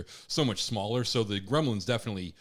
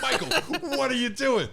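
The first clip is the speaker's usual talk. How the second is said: high, laughing shout of disbelief; high laughing yelp at a brother caught in the act; 12 semitones higher than usual. Retold or imitated. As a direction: cry out his name mid-laugh, shocked and amused. Catching brother sleep peeing.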